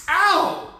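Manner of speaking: high mock ow; mock pain yelp. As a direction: yelp as if hit in the face.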